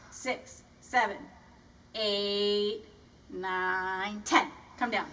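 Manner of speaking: ordinary talk, nothing marked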